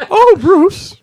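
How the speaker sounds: very high, loud falsetto; Julia Child voice. Acted. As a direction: squeal it like a dotty Julia Child. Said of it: "I read her as Julia Child".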